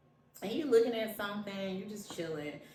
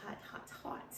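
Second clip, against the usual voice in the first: each hot softer until whispered; fading out; 10 dB quieter than usual. Pretend. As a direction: let it fade to a whisper. Echoing hot tea, fading.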